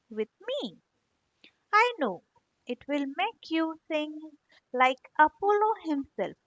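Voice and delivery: soft, sweetly flattering owl voice; spoke very kindly. Acted. Sly owl luring the grasshopper with soft kind words. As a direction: coax him up with honeyed kindness. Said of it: "spoke very kindly".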